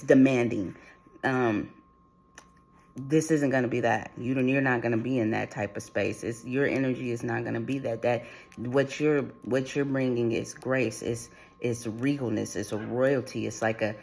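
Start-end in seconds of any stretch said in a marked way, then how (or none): none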